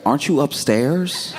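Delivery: high and incredulous, caught out; mock incredulous. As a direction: friends calling out his lie, in a high mock disbelief. Texter catches his lie.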